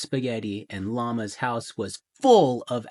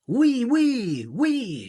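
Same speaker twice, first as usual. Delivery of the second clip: shouted oui; 11 semitones higher, much slower than usual. Acted. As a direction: squeal it like a crowd of alpacas. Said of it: "shouted the alpacas".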